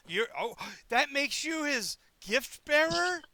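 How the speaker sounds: high, very loud delighted outburst; gushing excitement. Acted. Player gushing over a gift bearer, thrilled and loud.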